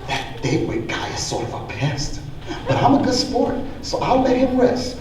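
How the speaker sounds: soft, breathy whisper with a chuckle. A narrator's reading. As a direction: whisper behind the host's back. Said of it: "whispered to the bug and the spider".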